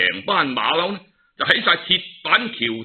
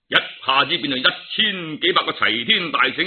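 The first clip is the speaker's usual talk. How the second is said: usual speaking voice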